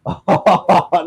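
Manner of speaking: high, loud burst of laughter. Real, not acted. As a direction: laugh out loud at the unfair loss. Laughs at losing.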